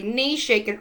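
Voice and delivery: usual speaking voice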